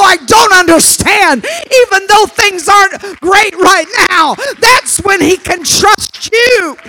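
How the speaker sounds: loud, shouted sermon delivery; full-force preaching. Acted. Preacher to his congregation, loud fervent shouting. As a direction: preach it out to the whole church.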